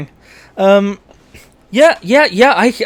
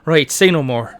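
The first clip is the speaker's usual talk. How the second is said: lilting Irish character voice; Irish accent. Acted. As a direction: size up strangers in a broad Irish accent. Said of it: "I'm shouting in an Irish accent".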